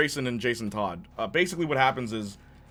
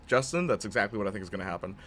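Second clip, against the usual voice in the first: usual speaking voice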